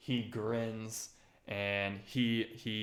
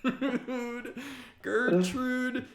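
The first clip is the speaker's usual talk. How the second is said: a much higher, squeaky gnome voice cracking into laughter; shy gnome woman; 13 semitones higher, 12 dB louder, slower than usual. Acted. Gnome woman shyly introducing herself to the table, high and giggly. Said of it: "She's kinda shy".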